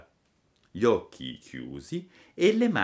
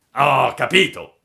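loud, raised villain voice; assassin's voice; 4 semitones higher, 11 dB louder than usual. A narrator's reading. One assassin to his accomplice, loud villain voice. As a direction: announce it forcefully as the villain. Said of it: "disse allora uno di loro".

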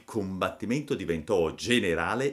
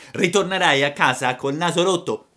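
raised, high-pitched bully's warning; angry threat; 9 semitones higher, 7 dB louder than usual. Acted. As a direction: escalate against the mocker. Boy threatens broken nose. (minaccia rabbiosa)

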